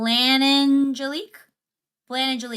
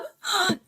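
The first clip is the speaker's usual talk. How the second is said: excited gasp; 8 semitones higher than usual. Real, not acted. Thrilled by a new sub.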